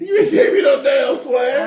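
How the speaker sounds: loud, slow, outraged; indignant shout. Real, not acted. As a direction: shout it back in protest. Playful argument over swag.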